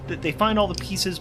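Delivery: normal talking voice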